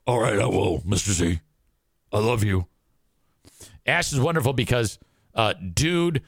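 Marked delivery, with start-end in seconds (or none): 0.1-2.7 lightly put-on low voice, obedient; deep Ash voice. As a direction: lower mock-deep voice of Ash. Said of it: "Ash's voice is super deep now".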